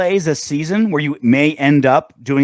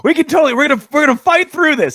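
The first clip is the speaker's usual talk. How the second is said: high, loud overdramatic pep talk; hammed-up rallying cry, pitched way up; Michael Scott; 11 semitones higher, 3 dB louder than usual. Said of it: "Michael Scott's like".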